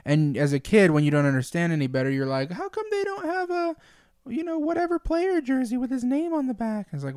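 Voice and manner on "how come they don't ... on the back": put-on high, whiny little kid voice; child voice. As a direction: ask it like a small kid. Imitating child's question.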